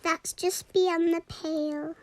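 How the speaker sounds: ordinary delivery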